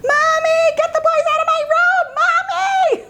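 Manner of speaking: very high squealing shout for mommy; imitated daughter's yell. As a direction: shriek for mom's help like a little girl. Daughter calls mother for help.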